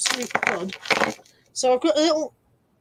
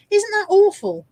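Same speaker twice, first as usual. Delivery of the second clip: excited; 9 semitones higher, 7 dB louder, slower than usual. Real, not acted. Showing off ugly necklace.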